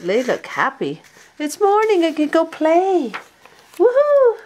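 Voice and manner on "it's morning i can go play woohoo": high loud joyful cheer; gleeful high woohoo; excited puppy. Acted. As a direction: burst out cheering for morning. Puppy excited morning play.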